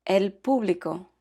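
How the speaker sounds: "el público" said in Spanish, stress on "pú", final o sounded; Spanish pronunciation. Shown as a demonstration. Teacher models a Spanish -ico word for learners, Spanish pronunciation. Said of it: "add the letter O to the end".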